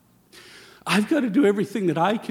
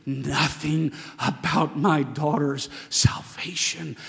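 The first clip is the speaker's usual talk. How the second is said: ordinary delivery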